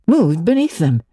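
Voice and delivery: usual speaking voice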